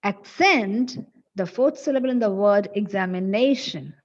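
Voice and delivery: "accent" with CENT punched; stress on second syllable. Shown as a demonstration. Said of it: "it should be pronounced as".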